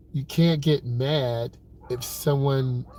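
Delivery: ordinary talk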